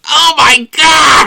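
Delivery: high, slow, loud mock scream; screaming terror. Kid hears the ghost story ending, screaming in mock terror. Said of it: "Sarah just goes".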